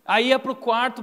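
usual speaking voice

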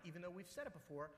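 normal talking voice

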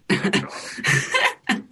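high, drawn-out burst of laughter. Real, not acted. Musician admitting he plays Saint Anger, laughing. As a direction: laugh it out after your confession.

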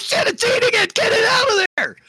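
high, frantic shouting; shrill-pitched, urgent holler; panicked yell. Acted. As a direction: scream in alarm to get it out. Panicked yelling at something eating.

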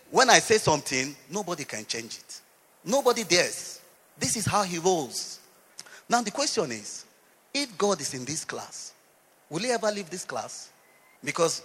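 ordinary talk, nothing marked

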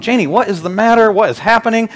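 high, exasperated questioning; exasperated dad. Retold or imitated. Dad to crying daughter in the car, exasperated. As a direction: act out a dad at his wit's end. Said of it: "I'm going".

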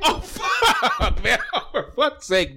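helpless laughter around the curse; cracking up, can barely get it out; laughing. Real, not acted. Host can't stop laughing at Matt, laughing.

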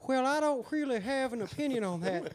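sing-song West Virginia twang; West Virginia pillbilly. Pretend. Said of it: "I'll do a West Virginia pillbilly".

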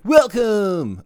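high, loud radio DJ greeting; hammed-up radio announcer hello. Shown as a demonstration. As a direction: belt out a big DJ welcome. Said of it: "classic radio D J voice".

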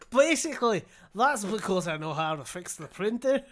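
put-on loud Irish accent through laughter, drifting Scottish. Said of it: "I can't keep it from going Scottish".

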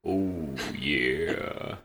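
low, drawn-out oh yeah. Pretend. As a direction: drop your voice and stretch it out. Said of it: "pitch your voice down".

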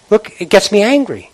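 usual speaking voice

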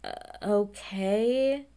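slow, dazed uh okay; a hint of awkward shock; stunned okay. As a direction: drag it out like a stunned man. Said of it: "he's just standing there like".

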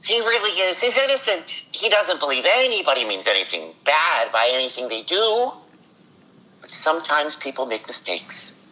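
high, gentle sweetheart voice; sweet innocent guy voice. Acted. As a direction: play a kind, innocent soul. Guest voices sweet character.

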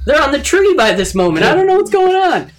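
climbing into a high squeak of confusion; mock panicked monster. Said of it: "monster's inner voice acted".

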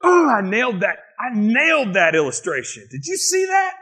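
high, boastful mock crowing; showy self-praise rising to a squeal; self-congratulation. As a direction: act thrilled with your own performance. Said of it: "I won't be talking about him going".

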